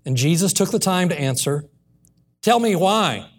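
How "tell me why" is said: higher-pitched retold demand. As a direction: press for an answer. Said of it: "Martha demanded answers".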